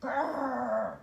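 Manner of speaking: loud growling bear. Acted. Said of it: "growls a polar bear".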